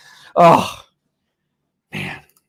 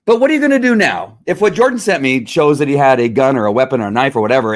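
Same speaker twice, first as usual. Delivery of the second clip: normal talking voice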